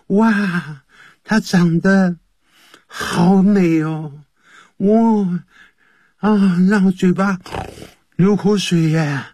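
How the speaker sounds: put-on high, slow, dreamy gushing over a pretty girl; swooning admirer. Teacher plays a smitten boy, hammed-up high gushing. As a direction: gasp with mock drooling delight.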